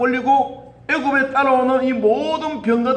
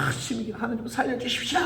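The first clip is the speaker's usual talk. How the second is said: mock desperate prayer; 10 dB quieter than usual. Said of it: "그렇게 기도했어요".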